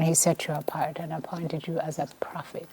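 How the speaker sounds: hushed whisper at the verse's end; whispered scripture. Real, not acted. Host reciting scripture to the mayor, whispering. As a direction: let the last line fall to a whisper.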